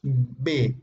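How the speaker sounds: B, lips pressed, slow; B from both lips; demonstrating B sound. Shown as a demonstration. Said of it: "Usas los dos labios para pronunciar el sonido de B".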